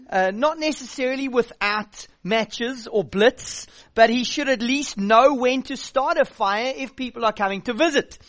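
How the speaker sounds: ordinary talk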